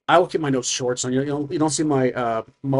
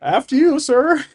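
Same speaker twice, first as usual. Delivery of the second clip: very high, loud put-on voice; high-pitched, loud mock deference; Mel's character voice; 12 semitones higher, 7 dB louder than usual. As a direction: usher him ahead, pitched way up. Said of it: "He's like".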